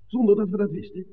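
the truth dawns on you mid-sentence; shocked question. Acted. Smuggler realises they unknowingly carried drugs, asks in high dismay.

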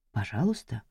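тихо, низко, с издёвкой; передразнить мольбу вполголоса; насмешливый повтор. Acted. (тихая холодная издёвка)

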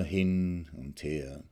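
drifting, dwindling hin und her; fading away. A narrator's reading. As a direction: let hin und her trail off slowly and quietly.

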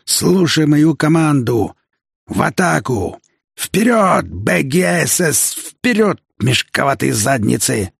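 high, drawn-out battle orders; rally the platoon over the din; commander's roar. A narrator's reading. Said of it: "командирский рык". (командирский рык)

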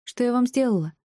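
high, upset protest; mildly shouted indignant question; indignant cry. A narrator's reading. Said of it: "закричала она старушке".

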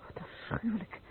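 aghast whisper; whispered horror. Acted. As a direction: whisper it, shocked. Shocked reply to burn victim.

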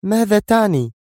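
high, tense woman's question. Acted. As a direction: pitch it way up as she demands meaning. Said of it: "قبل أن تسأله منى". (امرأة تسأل بصوت مرتفع الطبقة)

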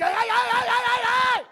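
very high, loud "ay" shouting; high-pitched hollering; mock wailing cry. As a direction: let out a loud, high run of yelps. Shrill mock cry in elevator story.

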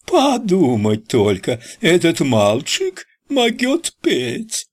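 very high, slow squealing voice; Pickaninny voice. Acted. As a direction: squeal it in a cartoon child voice. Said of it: "заверещал Ричи голосом Пиканини".

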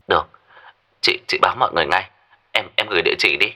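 stammered, hurried agreement on the phone; thin phone voice, tripping over first words; softly flustered caller arranging things; flustered phone reply. Acted.

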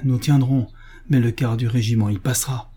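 ordinary delivery, nothing marked

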